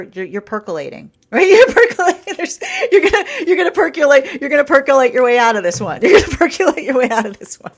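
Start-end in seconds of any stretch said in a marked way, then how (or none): 1.2-7.7 high, loud, laughing repeat. Real, not acted. Amused at her own advice, laughing loudly. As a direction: laugh through the punchline.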